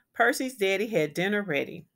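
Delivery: usual speaking voice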